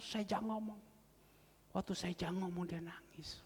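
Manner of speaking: normal talking voice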